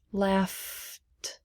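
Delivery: "laughed" with a held F, then T; softly drawn-out "laughed", showing F then T; no E sound. Shown as a demonstration. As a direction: stretch the F in "laughed" before the final T. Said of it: "No E sound".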